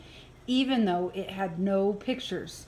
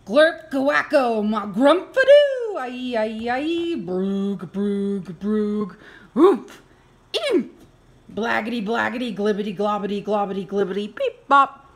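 loud, goofy nonsense noises blurted; silly sound shouts; 4 semitones higher, 8 dB louder than usual. Acted. Grown-up forced to make silly sounds, loud and goofy. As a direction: ham up each silly noise.

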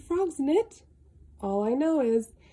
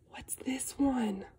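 whisper; 6 dB quieter than usual. Acted. Reading book to child.

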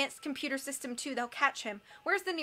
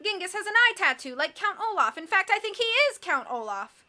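higher, raised exclamation; dawning realization, pitched up; excited realization; 7 semitones higher, 4 dB louder than usual. A narrator's reading. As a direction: call out a sudden discovery. Mister Poe calls out his discovery.

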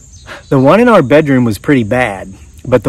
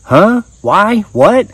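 high, startled burst of questions; 8 semitones higher, much slower than usual. Retold or imitated. Narrator surprised the rollie speaks English, high startled questions. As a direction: caught completely off guard. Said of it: "I asked, startled".

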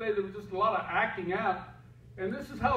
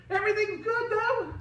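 very high sing-song cover-up; fake cheerful. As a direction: chirp it brightly to hide the gossip. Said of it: "Putting on a show like everything's great".

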